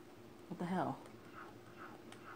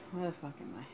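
normal talking voice

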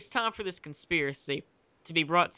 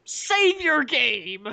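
loud, high, drawn-out wail; overblown, stretched dramatic cry; mock dark passion; 8 semitones higher, 5 dB louder, much slower than usual. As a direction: bellow it slowly with hammed-up passion. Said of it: "Samus delivered with dark passion".

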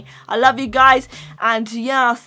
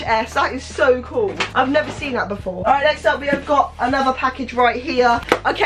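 normal talking voice

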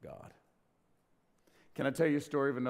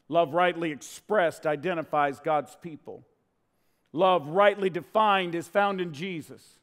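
rising, louder, slower with each line; building preaching; 6 semitones higher, 10 dB louder than usual. Real, not acted. Preacher to congregation, swelling louder. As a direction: build the refrain to a ringing climax.

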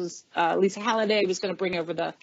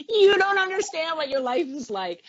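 high, quick mock impression of the assistant; imitation of me; 8 semitones higher than usual. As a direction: tease it in her voice, a little higher. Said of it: "her impersonation of me would be".

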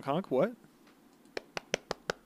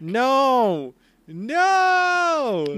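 loud, high, drawn-out mock denial; hammed-up cry of protest; protesting wail; 10 semitones higher, 6 dB louder than usual. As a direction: wail in playful refusal, climbing higher. Playfully refusing news that he returns.

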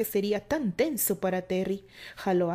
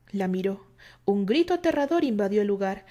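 ordinary delivery

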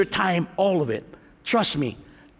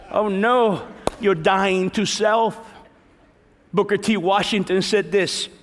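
normal talking voice

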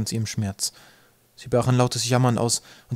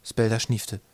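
ordinary delivery

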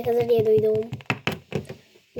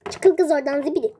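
usual speaking voice

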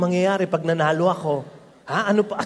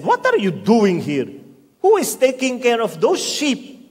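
put-on angry older brother; 5 semitones higher, 3 dB louder than usual. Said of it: "he became angry and he said".

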